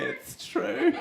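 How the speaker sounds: high-pitched imitation of an audience member; audience heckle. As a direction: pipe up high from the crowd. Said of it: "hear someone say".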